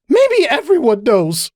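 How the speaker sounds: high, drawn-out cry; yelling. A narrator's reading. Cupcake yelling so it carries down the hall, high yell. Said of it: "Cupcake yelled".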